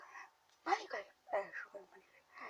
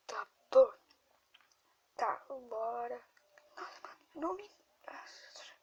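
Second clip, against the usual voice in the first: ordinary talk, nothing marked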